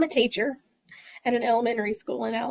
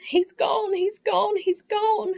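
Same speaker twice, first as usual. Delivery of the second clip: high, urgent repeated cry; mock frantic scream of a grieving mother; retold screaming; 10 semitones higher, slower than usual. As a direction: echo her mother's frantic scream, pitched up. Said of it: "she just kept screaming".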